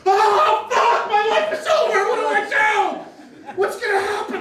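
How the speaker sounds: high, loud panicked babble; panicked driver. Retold or imitated. Driver who just hit a cyclist, high loud panic. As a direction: spill it out in a panic.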